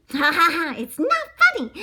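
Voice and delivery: put-on very high, loud baby bunny voice; a little bunny laughing then protesting; squeaky baby bunny.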